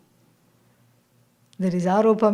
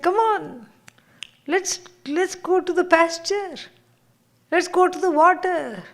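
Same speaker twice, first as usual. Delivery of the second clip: high calling-out voice, pitched up; calling the cows; 7 semitones higher than usual. Acted. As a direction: cheerfully call the herd to the water. Student calling the cows to pasture.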